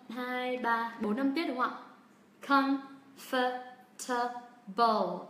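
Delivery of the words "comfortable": "Comfortable" split into four equal, separated syllables; drawn-out syllable-by-syllable "Comfortable" with no stress; no word stress. Shown as a demonstration. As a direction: say "Comfortable" very slowly, every syllable evenly weighted. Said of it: "hoàn toàn mình đang đọc không có trọng âm".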